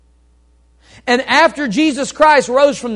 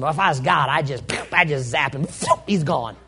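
a touch of smug vengeance; smug would-be God; 8 semitones lower, 6 dB quieter, faster than usual. Retold or imitated. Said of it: "you hear people say this stuff".

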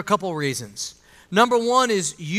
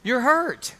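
ordinary delivery

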